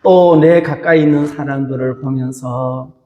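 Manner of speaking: ordinary talk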